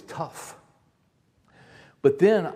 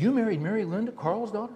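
astonished; 7 semitones higher than usual. Retold or imitated. Caller repeats in amazement.

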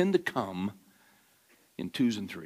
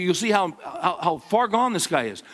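ordinary talk

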